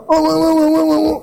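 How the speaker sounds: high, sustained run of "oh"s. Acted. Preacher imitating people who raise their voice, mock ranting. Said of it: "Sebagian orang kalau berbicara angkat suara".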